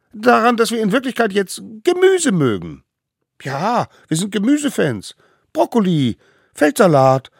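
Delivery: put-on high, loud eager kids' voice; little wild ones. A narrator's reading. Little wild ones insisting to the judges, high and loud. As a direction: talk fast to convince.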